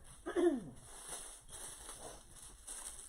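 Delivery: dry throat coughs; coughing on dust. Real, not acted. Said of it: "got dust in my throat".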